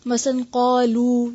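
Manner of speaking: قالو slow with the waw stretched long. Shown as a demonstration. Teacher modelling long waw in قالو for students. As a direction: draw out the end of قالو. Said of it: "پڑھیں گے قالو".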